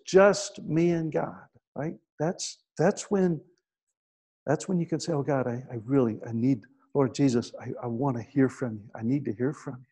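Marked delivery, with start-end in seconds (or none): 5.1-9.9 soft, low, earnest plea; quiet prayer. Acted. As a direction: pray it privately, under the voice. Acts out private prayer.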